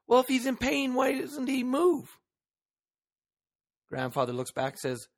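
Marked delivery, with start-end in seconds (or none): 0.1-2.2 put-on higher, childlike voice of a curious grandson; young grandson. Grandson asks his granddad on the porch, curious childlike voice. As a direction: pitch it up like a small boy asking.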